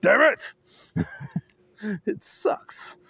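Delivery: normal talking voice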